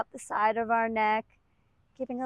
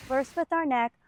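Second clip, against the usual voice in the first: ordinary talk, nothing marked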